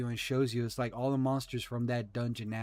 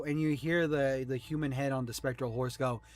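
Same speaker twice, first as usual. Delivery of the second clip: normal talking voice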